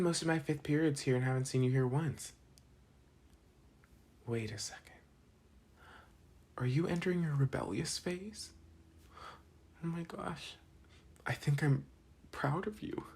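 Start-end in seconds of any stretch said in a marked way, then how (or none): 4.3-4.8 hushed, conspiratorial whisper. Acted. Realizing the valedictorian skipped class, whispering. Sudden realization about rebellion. 6.6-13.0 higher, gushing mock delight; excited. Classmate teasing the valedictorian, with hammed-up high-pitched delight.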